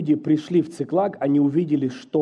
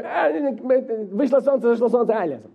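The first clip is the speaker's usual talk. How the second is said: careless shrug voice; 6 semitones higher than usual. Retold or imitated. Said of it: "Кто-то такой".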